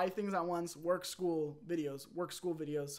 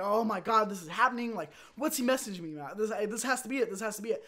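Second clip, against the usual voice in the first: higher, louder frantic excitement; retold freaking out; 4 semitones higher, 5 dB louder than usual. Student gets a surprise message mid-midterm, excited freak-out. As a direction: blurt it out in giddy disbelief. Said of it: "I, like, freak out".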